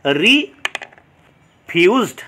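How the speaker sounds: Refused very slowly, long pause after re; syllable by syllable. Shown as a demonstration. Teacher showing students two syllables, slowly split. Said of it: "syllable দেখতে পাচ্ছি".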